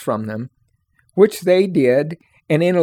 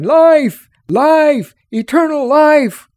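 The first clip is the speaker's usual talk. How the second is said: high, loud desperate shouts, the last slower; crying out; 11 semitones higher, 6 dB louder, much slower than usual. A narrator's reading. Fleeing man shouts back at his crying family. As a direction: yell it as you run with fingers in your ears. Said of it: "ran on crying".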